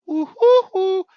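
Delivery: high, loud woo-hoo-hoo; big celebratory whooping; excited whoop. Real, not acted. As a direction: whoop it up for the crowd. Hyping the giveaway.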